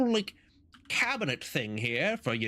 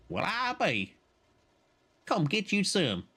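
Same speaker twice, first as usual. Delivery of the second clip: high folksy Southern voice; Southern accent; 6 semitones higher than usual. Acted.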